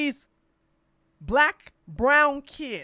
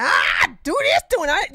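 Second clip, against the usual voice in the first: mock tough talk; 8 semitones higher, 7 dB louder than usual. Mocking internet tough talk.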